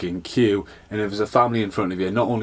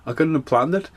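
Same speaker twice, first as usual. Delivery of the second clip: higher pitched, half-laughing mock disbelief; mock exasperated outburst; 8 semitones higher than usual. Podcaster hearing of the surprise Blu-ray release, mock exasperated. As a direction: throw up your hands at the bad timing. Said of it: "I'm like".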